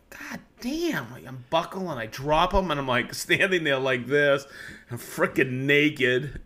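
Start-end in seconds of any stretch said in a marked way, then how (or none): 0.1-1.1 pitched way up, strained and softer; high strained curse. Retold or imitated. Standing in his shorts after the injury, high strained curse. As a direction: choke out the curse in pain.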